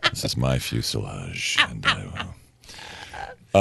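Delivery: calm, low captain reassuring passengers; steady in-control airline captain; soothing pilot voice. Pretend. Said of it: "it feels like you're in control".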